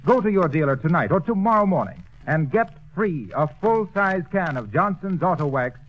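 ordinary delivery

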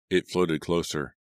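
plain reading voice

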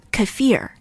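"kefir" as kuh-FEER, stress on the second syllable; correct pronunciation. Shown as a demonstration. Teacher correcting listeners, "kefir" stressed as kuh-FEER. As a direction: hit the end of "kefir": short kuh, then FEER. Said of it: "the correct pronunciation should be kefir".

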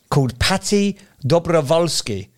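put-on slow, light Eastern European accent on the name. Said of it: "I don't know why I did it with that accent".

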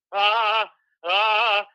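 loud, high dumbstruck "ah"; stuck on a loud, helpless "ah"; dumbstruck stammering. Retold or imitated. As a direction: imitate your own tongue-tied panic noise. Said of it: "this is how I sounded".

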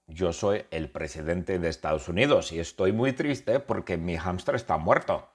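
ordinary talk, nothing marked

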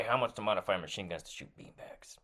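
usual speaking voice

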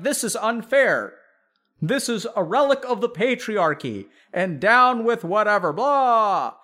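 lightly hammed-up protest speech; mock activist rant. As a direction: parody a self-righteous activist. Mocking a preachy activist rant.

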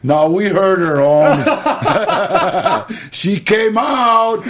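mock the scream, chuckling; teasing friend. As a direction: friend ribbing him loudly. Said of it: "Sonny goes".

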